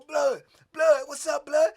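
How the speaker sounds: calling out. Retold or imitated. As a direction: shout across to your friend, a bit urgent. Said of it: "they tell me".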